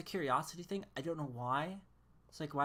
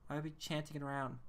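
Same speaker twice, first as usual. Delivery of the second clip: usual speaking voice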